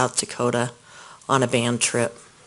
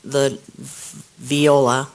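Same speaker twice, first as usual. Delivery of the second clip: ordinary talk, nothing marked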